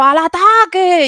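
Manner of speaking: high, loud, slow war cry; rally the troops with a yell; shouted battle cry. Retold or imitated. Voice coach reenacting her shout at the gaming table, loud battle cry.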